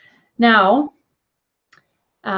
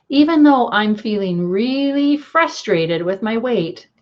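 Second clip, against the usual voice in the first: tapping affirmation. Shown as a demonstration. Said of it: "we say this three times to tune into the issue".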